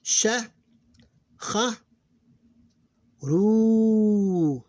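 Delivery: "Sha, kha, ru" read high and loud, each syllable held long; teacher demonstrating a Quran reading line, each syllable drawn out; drawn-out Arabic syllables. Shown as a demonstration. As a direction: chant each syllable slowly like a reciter. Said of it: "All right, line one".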